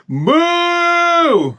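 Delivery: loud, high, drawn-out cow moo; big sustained high-pitched "moo"; imitated child's moo. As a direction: moo loudly at a dog like a cheeky kid. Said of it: "make direct eye contact with the dog, and go".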